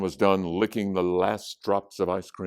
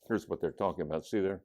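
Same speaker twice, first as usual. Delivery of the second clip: ordinary delivery, nothing marked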